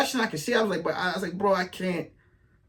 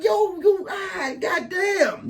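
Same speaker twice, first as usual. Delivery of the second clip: exasperated outburst; 11 semitones higher, much slower than usual. Retold or imitated. Retells his exasperated outburst.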